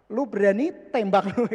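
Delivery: 1 rough threat. Retold or imitated. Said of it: "Kasar banget ngomongnya".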